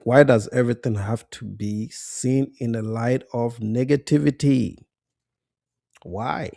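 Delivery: ordinary talk, nothing marked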